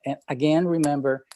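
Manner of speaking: ordinary talk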